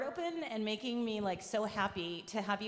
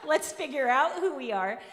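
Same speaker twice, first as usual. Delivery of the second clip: laughing; 5 semitones higher, 8 dB louder than usual. Real, not acted. Laughing after quoting the group.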